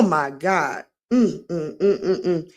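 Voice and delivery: normal talking voice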